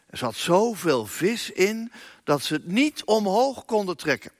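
ordinary talk, nothing marked

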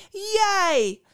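high, loud accusing shout; accusing cry. A narrator's reading. Antoine confronting the thieving innkeeper, shouting accusingly. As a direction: hurl it at the culprit. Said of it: "riep Antoine".

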